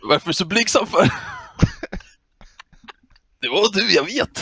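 high, insistent mock flattery; trolling fanboy. Troll insisting Span is being modest, high fanboy teasing. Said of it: "Man bara".

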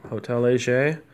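ordinary talk

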